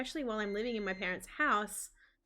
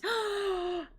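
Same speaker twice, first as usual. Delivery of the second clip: mock gasp; 11 semitones higher than usual. Addison hears Axel's date offer.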